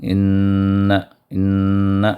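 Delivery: ان with a doubled ن held nasally two beats; ان, ن pressed and humming; nasal ghunna. Shown as a demonstration. Teacher showing ghunna on ان. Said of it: "اس نون کو پڑھیں گے".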